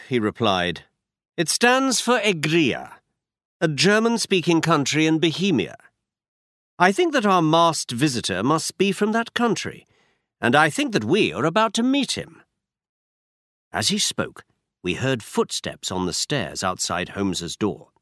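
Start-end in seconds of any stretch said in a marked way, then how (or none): 1.4-12.4 a slightly higher, brisk Holmes; Holmes, lightly voiced. A narrator's reading. Holmes instructing Watson, lightly voiced detective.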